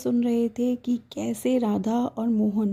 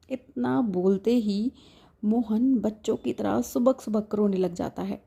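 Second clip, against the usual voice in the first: ordinary delivery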